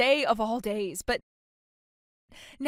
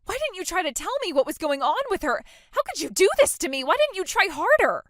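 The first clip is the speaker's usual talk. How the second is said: upset accusation; 4 semitones higher than usual. Acted. Bride blaming sister over news of mom's death, upset accusation. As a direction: throw the blame at them, higher and higher. Sister blames sister for not telling.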